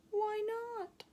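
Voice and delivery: high, puzzled little caterpillar; hurt why not. Acted. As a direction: ask it small and confused. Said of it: "asked Charlie".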